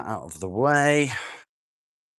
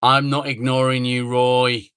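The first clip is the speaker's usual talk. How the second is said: loud reassurance to Roy; loud reply to a chat viewer; calling out to viewer; 3 semitones higher, 9 dB louder than usual. Real, not acted. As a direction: call it out so Roy hears.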